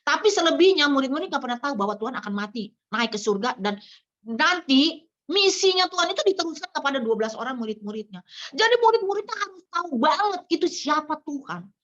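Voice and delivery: ordinary talk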